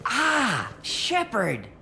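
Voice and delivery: high, excited exclamation, falling away; thrilled cry on spotting someone famous; delighted recognition. Acted. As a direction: greet a celebrity with sudden delight. Shopkeeper suddenly recognises famous customer.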